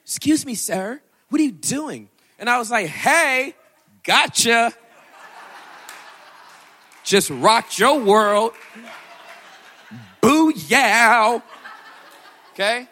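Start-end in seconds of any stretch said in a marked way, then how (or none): 0.0-2.1 loud, high startled question; a high-pitched, startled "what are you doing"; shocked cashier. Retold or imitated. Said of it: "That's always the question". 2.9-12.9 loud, high, laughing triumph; cheeky laughing victory cry; gleeful gotcha. Real, not acted. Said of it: "triumphant laughing gotcha".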